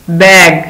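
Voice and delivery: بیگ said firmly with a short a; modelled word. Shown as a demonstration. Teacher naming the picture بیگ for children, clearly. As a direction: announce بیگ clearly for the class. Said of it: "یہ کیا ہے؟".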